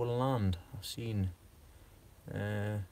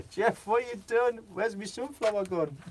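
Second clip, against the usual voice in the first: higher, mock-outraged wife asking her husband; wife to husband Jeff about the lost sunflower, mock indignant and higher; imitating his wife; 9 semitones higher than usual. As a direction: play the wife demanding an answer, pitched up. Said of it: "I'm not even going to attempt her accent".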